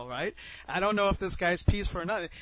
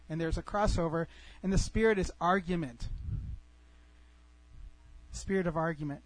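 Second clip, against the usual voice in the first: ordinary delivery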